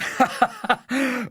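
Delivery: high, embarrassed chuckle; nervous Tsar laugh. Acted. Tsar caught out, nervous high laugh. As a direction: laugh it off awkwardly.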